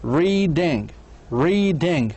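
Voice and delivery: "Reading" as two distinct beats, rea-ding; short pause between syllables. Shown as a demonstration. Teacher shows learners the short stop, syllable by syllable. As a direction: break "reading" after rea. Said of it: "وقف قصير".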